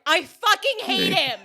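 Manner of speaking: high, loud, drawn-out hatred; mock furious yell. Friend reacting to Jedi Knight, furious yell. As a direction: imitate a friend wailing in outrage. Retells friend's fury after episode.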